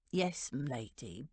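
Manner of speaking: low, slow butler's reply; reluctant, measured butler; deferential butler. A narrator's reading. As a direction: answer low and slowly, with servant's deference. Butler answers agitated lady deferentially.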